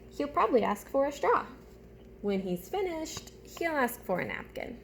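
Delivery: put-on animated storytime voice for young listeners; reading a picture book aloud. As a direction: read it like story time with little ones. Reads picture book to class.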